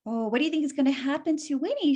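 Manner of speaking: plain reading voice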